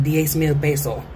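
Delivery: ordinary talk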